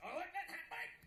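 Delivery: high, loud, laughing Australian; hammed-up Australian through a laugh; Australian accent attempt. Shown as a demonstration. Showing off to an impressed crowd, broad Australian accent. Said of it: "working on my Australian accent".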